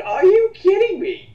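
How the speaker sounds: can't believe what you just heard; incredulous. Real, not acted. Reacting to a hater's lazy critique, high and loud disbelief.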